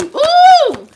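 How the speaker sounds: high, loud squeal of ooh; excited ooh. Real, not acted. Lights appear on camera.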